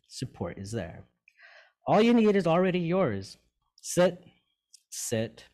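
measured, uplifting recital with long pauses; poem reading. Acted. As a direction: read it like a gentle blessing to the listener. Reads Sue's poem aloud.

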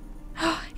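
quick, soft high gasp; startled intake of breath. Real, not acted. Player spotting Yidris in the game, surprised gasp. Spots a known character.

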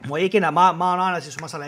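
ordinary talk, nothing marked

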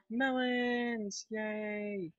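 high, drawn-out happy cheer; gasp, then cheer high and long; excited cheer. Real, not acted. Player celebrates finally finding melons, excited cheer.

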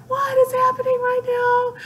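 very high squealed retelling of amazement; ecstatic disbelief. Retold or imitated. Fan recalling a surprise answer in the DM, ecstatic squeal. Acts out own thrilled disbelief.

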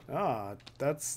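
usual speaking voice

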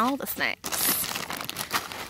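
slow breathy whisper; drawn-out whisper. Real, not acted. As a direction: breathe the words out slowly. Echoes the line in a whisper.

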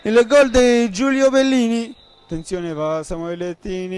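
ordinary delivery

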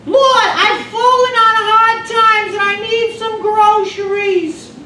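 high, loud, slow screechy plea; old lady crying out for groceries; pleading old woman. Retold or imitated. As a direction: beg the Lord in her shrill voice.

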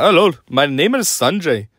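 mimicked Indian accent on a greeting; imitated Indian accent. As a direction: introduce yourself in a put-on Indian accent. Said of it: "India people do talk like that".

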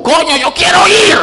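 wife yells high and loud; demanding shout. Retold or imitated. Wife demanding the strip club, shouting. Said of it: "en ese tono".

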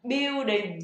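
"building" with stress on BIU, still no L; stressed but mispronounced. Shown as a demonstration. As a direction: punch the first syllable, "BIU-đinh", L missing. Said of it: "các bạn sẽ đọc đúng trọng âm". ("building" đúng trọng âm nhưng vẫn thành "BIU-đinh")

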